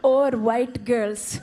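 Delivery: slow, drawn-out Indian mom voice; mom's Indian accent. Retold or imitated. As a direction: stretch each word like a scandalised mother.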